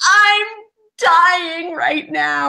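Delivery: high, slow, stretched-out squeal; hammed-up swooning with happiness, very high; overjoyed fangirl. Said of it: "I'm so happy".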